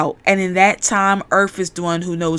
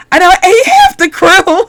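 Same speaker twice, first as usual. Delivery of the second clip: high, loud comic wail; hammed-up horrified outburst; mock horror; 11 semitones higher, 8 dB louder than usual. Man realizing he ate his crewmates, mock horror. Acted horror at eating crew.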